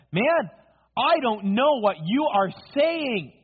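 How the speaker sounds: high, loud, drawn-out protest; heated denial. Acted. Cornered Peter answers the man accusing him, heatedly denying it. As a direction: hammer out each word in indignant denial.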